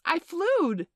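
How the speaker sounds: high, loud squeal of triumph; excited scream. A narrator's reading. Michael screams in midair after flying, thrilled. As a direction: squeal it out, amazed at yourself. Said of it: "he screamed".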